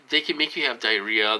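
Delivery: ordinary talk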